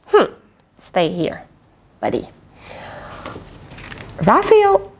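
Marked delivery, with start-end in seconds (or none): none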